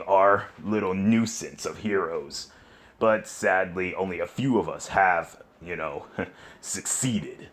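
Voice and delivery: low smug villain chuckling; villain doctor voice. Acted. Villain doctor chats with Flame.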